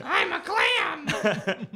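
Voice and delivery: very high, very slow squeaky clam voice; Clamantha. Acted. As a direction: stretch it out as a cartoon clam girl. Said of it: "I did the voice of a teenage clam named Clamantha".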